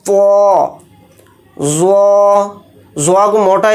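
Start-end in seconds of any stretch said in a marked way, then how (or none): 0.1-0.7 پو thick and full, unlike a thin تا; heavy full-mouth letter. Shown as a demonstration. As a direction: fill the mouth on پو. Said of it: "تو اس طرح سے اس کا sound نکلے گا". (پو کو پر آواز میں)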